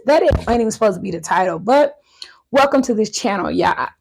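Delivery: ordinary delivery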